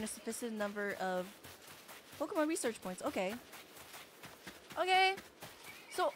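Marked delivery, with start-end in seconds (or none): none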